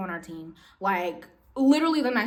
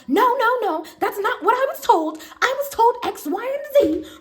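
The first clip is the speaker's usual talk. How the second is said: much higher and louder indignant denial; imitating a customer refusing to listen; shrill customer protest; 11 semitones higher, 6 dB louder than usual. Rude customer to staff, high loud protest.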